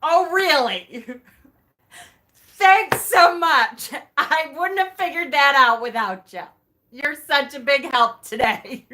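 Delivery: sarcastic thanks. Retold or imitated. Talking to her spirit guides, mock-grateful sarcasm. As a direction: thank them with heavy irony, laughing. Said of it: "I looked at my guides and I'm like".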